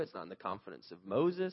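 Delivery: normal talking voice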